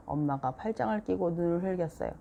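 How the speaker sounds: usual speaking voice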